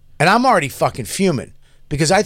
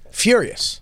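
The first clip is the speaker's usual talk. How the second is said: Furious said higher and emphatic; emphatic repeat; 8 semitones higher than usual. Shown as a demonstration.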